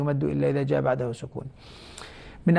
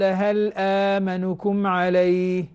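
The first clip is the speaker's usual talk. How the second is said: slow chanted verse, vowels held; Quranic recitation; much slower than usual. Shown as a demonstration. Teacher quoting the verse in recitation style, slow chant. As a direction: recite it as in prayer, unhurried. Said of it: "قال".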